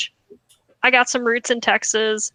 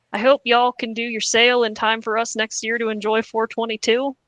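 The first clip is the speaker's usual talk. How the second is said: Southern accent. Real, not acted. Host reads a customer's late-sale complaint aloud, Southern accent. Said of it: "slipping into a Southern accent reading this".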